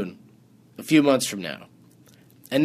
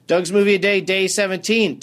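upbeat host announcing the show, higher and louder; show intro; 7 semitones higher, 4 dB louder than usual. Acted.

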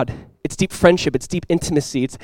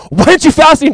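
much higher and louder, an accusing challenge; accusing critic; 10 semitones higher, 7 dB louder than usual. Retold or imitated. Religious critics to Jesus, accusing challenge. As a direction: demand it like a scandalised critic.

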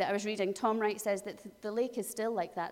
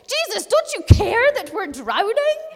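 much higher, louder panicked yell; desperate shout; 11 semitones higher, 15 dB louder than usual. Acted. Disciples shouting at sleeping Jesus, panicked. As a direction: shout it over the storm. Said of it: "What they shout is".

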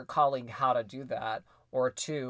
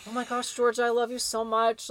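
high, mock-gushing lovestruck voice; 9 semitones higher, 4 dB louder than usual. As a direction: pour on the adoration for George. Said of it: "All I do is like".

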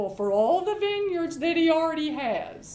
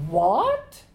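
sharp, louder startled "What?"; shocked what; 5 semitones lower, 5 dB louder than usual. Retold or imitated. Wife Jezebel hearing Naboth refused the king, a loud shocked "What?". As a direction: bark out "What?" in mock outrage. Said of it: "Naboth won't sell me his vineyard".

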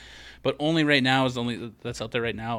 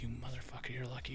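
under the breath; 16 dB quieter than usual. Retold or imitated. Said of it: "I'm like".

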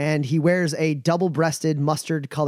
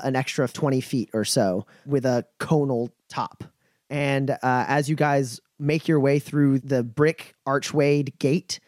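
ordinary talk, nothing marked

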